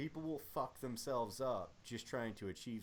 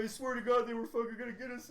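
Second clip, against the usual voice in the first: higher, fast mock panic; panicked soldier; 10 semitones higher, 5 dB louder, faster than usual. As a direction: soldier swears to the returning convoy, higher and fast. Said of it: "he's like".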